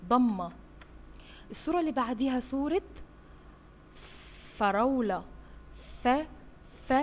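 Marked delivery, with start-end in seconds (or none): 6.0-6.9 isolated ف, said twice; letter sound f. Shown as a demonstration. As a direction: puff out ف alone. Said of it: "صوت حرف". (نطق صوت ف منفصل)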